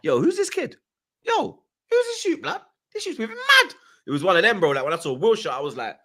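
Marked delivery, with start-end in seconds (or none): 0.0-3.8 high, awed exclamation; amazed. Retold or imitated. Remembering seeing Fabregas play, high amazed voice. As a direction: voice shoots up in disbelief.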